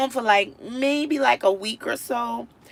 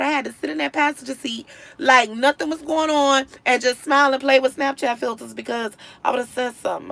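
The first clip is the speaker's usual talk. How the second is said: usual speaking voice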